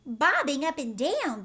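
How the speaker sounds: loud alarmed cry; startled outcry; cried out. A narrator's reading. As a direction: shout it back in shock. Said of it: "they cried".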